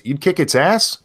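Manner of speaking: lightly voiced little granddaughter; retold child's question. Granddaughter asking grandpa about the Grinch, lightly voiced child.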